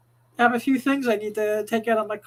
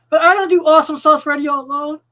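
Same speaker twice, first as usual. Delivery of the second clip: loud; 5 semitones higher, 10 dB louder than usual. Real, not acted. Host hype.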